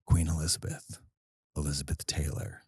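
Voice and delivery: low, soft scheming purr; a diabolical demand told to the manager, low and hushed. Acted. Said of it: "I'm gonna do this in this voice 'cause it's diabolical".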